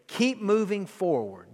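slow and deliberate, each word weighed; slow, earnest charge to the congregation; slow exhortation. Acted. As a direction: lay it down slowly like a promise. Preacher's closing exhortation.

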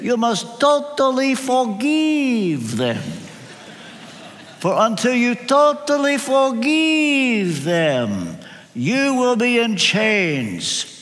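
put-on slow, high Romanian accent, totally stretched out; light Romanian accent. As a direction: lay on a Romanian friend's accent, slow and earnest. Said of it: "If I could put on a little bit of Romanian accent".

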